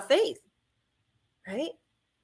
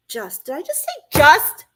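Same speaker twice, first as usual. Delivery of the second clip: catching herself laughing; 5 semitones higher, 7 dB louder than usual. Real, not acted. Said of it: "Did anybody out there catch me saying just?".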